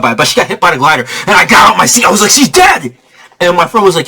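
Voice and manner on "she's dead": excited shout. Retold or imitated. Jumping out of seat.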